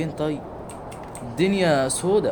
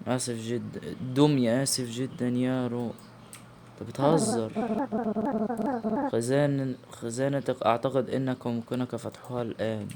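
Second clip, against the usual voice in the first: hushed sorrow; 3 semitones lower, 8 dB quieter than usual. Real, not acted. Sad apology scene.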